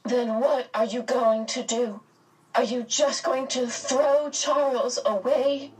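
high, anguished questioning; despairing cry. A narrator's reading. Meg confronting the silent three, despairingly. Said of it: "she cried out despairingly".

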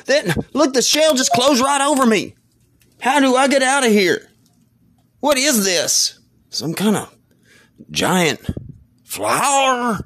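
high squealing panic, trapped in the shell; rising frantic high voice; panicked shriek. Acted. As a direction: yelp it out like you're stuck inside a clam. Man trapped in giant clam.

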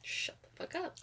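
hissed whisper. Real, not acted. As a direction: whisper it so nobody hears. Embarrassed whispered retort.

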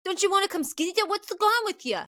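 high, hurried, mock-angry taunts; imitating boys getting worked up; enraged heckling. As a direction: badger someone in a high, riled-up voice. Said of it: "starting to get enraged".

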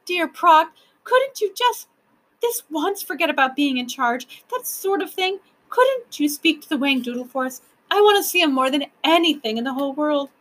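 put-on high, pleading child voice; imploring. A narrator's reading. Lindy begging the proc for a favour, imploring. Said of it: "She gazed imploringly".

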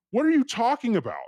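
very high, incredulous reenacted retort; high-pitched mock incredulity; retold disbelief. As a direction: act out total bafflement at a cop. Baffled reply to the cop.

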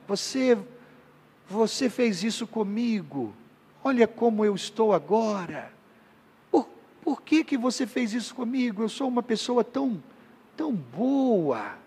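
soft, wounded guilt trip; manipulative guilt trip. Retold or imitated. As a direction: play the hurt victim, quietly. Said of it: "aquelas pessoas que manipulam".

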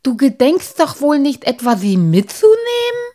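high, loud horrified question; alarmed protest, rising sharply; aghast. A narrator's reading. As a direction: climb to a squeal of disbelief. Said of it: "sagte Halbschuh erbleichend".

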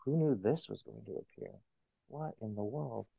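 soft, breathy inner voice, amazed; hushed wonder. Retold or imitated. Author recalling her heart's reaction to the story, soft wonder. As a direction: murmur it to yourself in disbelief. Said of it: "my heart was like".

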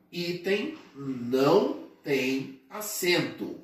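"item não tem acento" slowly, word by word; slow, emphatic. Shown as a demonstration. Teacher drilling an exam favourite into students, slow and emphatic. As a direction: spell out the rule with pauses between words.

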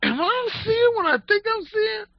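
very high mock disbelief; double take. Stock boy catching them in the aisle, high disbelief. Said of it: "he did a double take, like".

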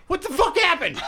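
laughing confusion. Retold or imitated. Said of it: "I'm like".

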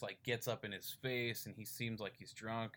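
ordinary delivery

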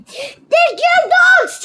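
high, loud, drawn-out command; shouted order. A narrator's reading. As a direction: yell it slowly as an order. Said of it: "he shouted".

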